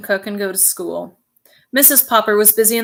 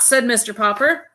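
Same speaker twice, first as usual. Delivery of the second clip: ordinary delivery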